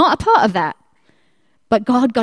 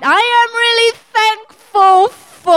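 straining for gratitude; 10 semitones higher, 6 dB louder than usual. Acted. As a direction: labour through it as if digging deep for something. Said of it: "they must have been digging deep".

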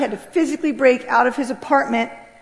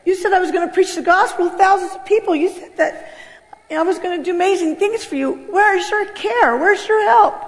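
much higher, pleading cry; retold tearful plea; 7 semitones higher than usual. Homeless girl crying out to Jesus, high and pleading. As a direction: beg Jesus through tears. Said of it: "began to cry my eyes out, saying".

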